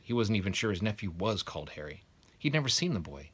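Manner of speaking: usual speaking voice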